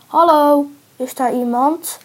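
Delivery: calling out. A narrator's reading. Men hears someone and calls from the cage, calling out. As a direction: shout to find out who is there. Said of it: "riep Men".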